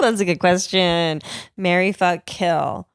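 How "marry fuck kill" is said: normal talking voice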